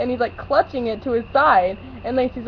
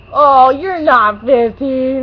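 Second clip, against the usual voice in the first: slow, louder drawn-out insisting; slow mock of the creep; mock creepy man; 6 dB louder, much slower than usual. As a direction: drawl it like a creep who won't budge.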